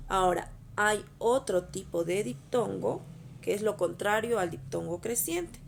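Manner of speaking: ordinary delivery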